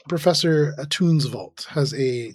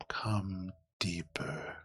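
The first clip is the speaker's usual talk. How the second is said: slow, hushed ghostly whisper; eerie whispered summons; 5 semitones lower, 14 dB quieter, much slower than usual. Acted. Game master voicing the voice beneath the floors, slow whisper. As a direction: draw it out as a low whisper from below. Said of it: "murmuring around the corner".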